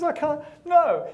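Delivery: high-pitched mock wail of denial; hammed-up grief, voice climbing; distraught owner. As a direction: wail in comic disbelief at bad news. Acted owner refuses the bad news.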